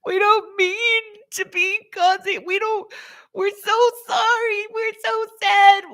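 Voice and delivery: very high, crying voice of sorry crystals; crystals character. Acted. Improv crystals pleading with the woman, crying high. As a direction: wail the apology as tiny weeping crystals. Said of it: "I totally went into character".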